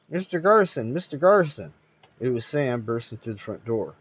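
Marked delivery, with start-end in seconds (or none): 0.1-1.7 high, urgent calls across the lobby; calling out. A narrator's reading. Sam bursting through the door calls Phil, urgent. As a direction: call his name as you burst in.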